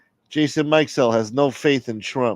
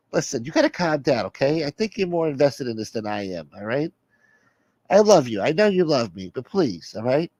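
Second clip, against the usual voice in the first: slightly raised voice, placating; exasperated soother, pleading; 4 semitones higher than usual. Acted. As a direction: plead with the caller to settle down. Plays the soother.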